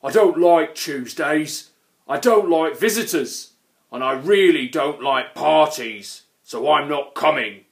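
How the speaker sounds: loud, grumpy troll refusal; growling troll. A narrator's reading. Grumpy troll refusing a party invitation, loud and growling. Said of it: "growled the Grunt".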